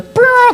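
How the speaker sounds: high trilled brrr; high-pitched rolled-lip toot; party blower imitation. Shown as a demonstration. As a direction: imitate a party horn unrolling.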